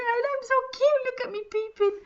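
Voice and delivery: high and cutesy, playing adorable; cutesy peeping voice. Host peeking at camera, cutesy high voice. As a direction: coo it like you're peeking out shyly. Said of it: "I'm peeping over to see if you can".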